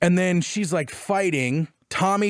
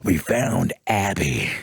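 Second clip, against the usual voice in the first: much lower, slow and weighty; grim Tommy; 11 semitones lower, slower than usual. Retold or imitated. Tommy bringing Ellie grim news, low and slow. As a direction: drop low and heavy, a beaten man. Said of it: "he's like".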